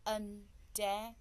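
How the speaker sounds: "undamaged" split into three separate beats; syllable by syllable. Shown as a demonstration. Teacher shows learners three syllables in "undamaged", syllable by syllable. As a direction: pause after each syllable of "undamaged". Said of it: "It's three syllable".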